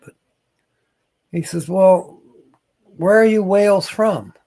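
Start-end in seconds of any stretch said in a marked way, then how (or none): 1.7-4.3 higher, louder quoted question; raised voice, delivering the punchline; joke punchline. Acted. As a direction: call it out as the bar joker's question. Drunk man's punchline in bar joke.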